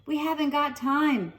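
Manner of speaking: put-on hurried, breathy girl; breathless. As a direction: rush it out of breath. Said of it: "said Stella breathlessly".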